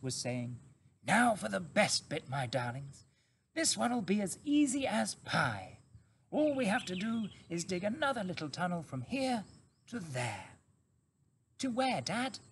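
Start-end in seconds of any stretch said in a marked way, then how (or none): 1.0-10.5 lightly voiced confident father fox; a hint of a jaunty father; Mr Fox, cheerful. A narrator's reading. 11.6-12.4 curious fox cub. A narrator's reading. Cub asks.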